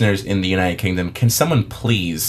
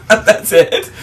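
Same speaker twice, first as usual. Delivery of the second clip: high, loud, laughing question; laughing disbelief; 11 semitones higher, 5 dB louder than usual. Real, not acted. Co-host reacts to the tiny demo, laughing in disbelief. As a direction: ask it through incredulous laughter.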